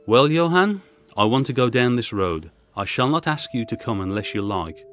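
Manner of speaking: ordinary talk, nothing marked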